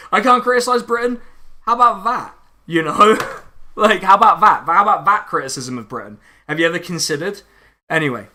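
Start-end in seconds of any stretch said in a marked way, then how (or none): 0.1-1.2 loud, high-pitched indignant question; raised, louder voice, affronted and mocking; incredulous echo. 1.6-6.2 loud, high comeback with a laugh; raised voice, cheeky and triumphant; defiant retort. Acted. Defiant mock retort to critics.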